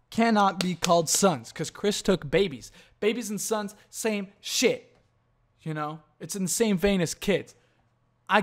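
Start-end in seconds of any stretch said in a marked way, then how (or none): none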